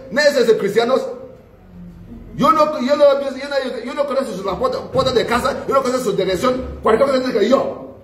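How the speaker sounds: a preacher voicing a self-pitying devil; whining devil. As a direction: plead as a wronged devil. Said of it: "devil was crying".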